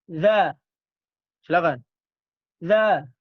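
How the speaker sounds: za said loud, tongue tip to upper teeth; Arabic letter za. Shown as a demonstration. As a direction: show the za sound clearly. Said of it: "Bertemu ujung gigi seri". (za diucapkan dengan ujung lidah di gigi atas)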